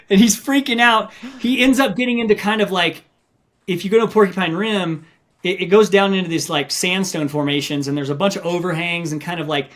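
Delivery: ordinary talk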